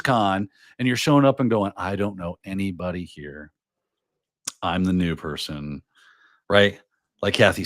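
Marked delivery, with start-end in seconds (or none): 1.8-5.8 quieter, lower nervous newbie; a hint of a lost first-timer muttering to themselves; mock timid newcomer. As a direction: shrink into the new kid at the con.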